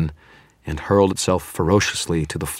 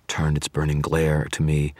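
ordinary delivery, nothing marked

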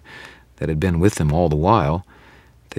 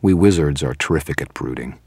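plain reading voice

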